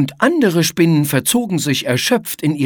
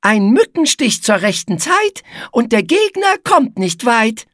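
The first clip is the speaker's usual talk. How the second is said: high, squeaky spider voice, mischievous; sly smile; 9 semitones higher than usual. A narrator's reading. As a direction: share the trick with a crafty smile. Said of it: "Igitte lächelte verschmitzt".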